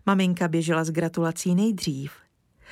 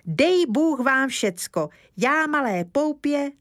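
put-on high, sing-song childish recitation; child reciting; 7 semitones higher than usual. Kája reciting the verse to Mr Lesní, high sing-song. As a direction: rattle off the verse like a proud boy. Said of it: "když Kája spustí".

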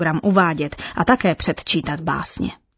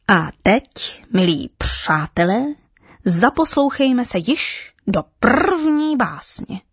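1 grand announcement; 5 semitones higher, slower than usual. A narrator's reading. Parrot introduces first poem.

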